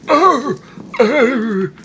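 high, loud mock-zombie groans; imitated zombie groan. Player showing how pollen makes her walk around, mock zombie groaning. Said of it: "It's turning me into a zombie".

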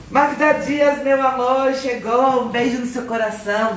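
high, bright hello to a viewer; cheery high live-stream greeting; sing-song greeting. Real, not acted.

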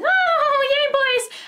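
high, squealing fangirl voice; giddy girls swooning over gondoliers. Retold or imitated. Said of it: "And they're like".

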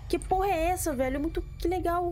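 sudden high-pitched what the hell; startled high exclaim. Real, not acted. Streamer startled by a weird weapon, squeaky surprise.

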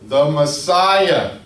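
loud, drawn-out proclamation; slow, loud cry of a title; shouting. Retold or imitated. Beggar shouting out to Jesus passing by, loud slow call. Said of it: "Bartimaeus is shouting".

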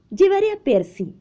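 put-on high-pitched little boy's voice; eager boy. Boy tells mother he will bring the parakeet, eager boy. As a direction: pipe up high like an insistent child.

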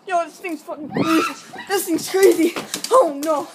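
loud, high, frightened outburst; panicked cry. Pretend. Vlogger warning viewers about the crazy thing, panicked cry. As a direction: cry out as if being chased. Pretends something is chasing them.

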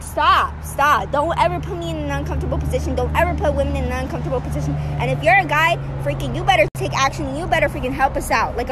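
usual speaking voice

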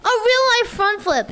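high-pitched, hyped stunt announcer; showy announcement. Pretend. Streamer introducing a stunt to chat, high showy announcement.

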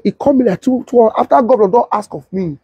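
ordinary delivery

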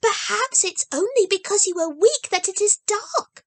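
put-on high, bright child voice, earnest; a small girl promising with full conviction; eager little girl Heidi. A narrator's reading. Heidi hopes for grandmother.

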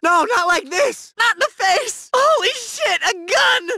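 high, frightened cries; panicked cry at a gun pointed at you; terrified pleading. Acted. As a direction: beg for your life at gunpoint. Bystander faces a drawn gun.

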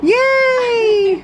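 high squeaky cheer; voicing the dog. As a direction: squeal it for the pet.

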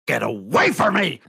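loud snarled shout; angry scream. Acted. Captain screaming at the helper, angry shout. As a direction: shove her off with a yell. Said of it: "basically screams".

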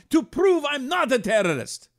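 put-on high Iranian accent, animated; Persian accent. Owner joking about airport security, Iranian accent. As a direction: insist on being stopped, Persian accent. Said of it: "I went to the accent for a minute".

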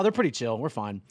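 usual speaking voice

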